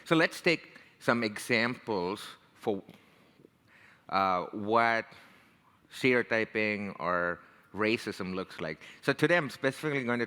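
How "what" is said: normal talking voice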